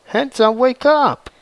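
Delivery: mildly acted desperate cry; desperate plea. A narrator's reading. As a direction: beg him to wake, panic creeping in. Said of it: "Bree getting desperate".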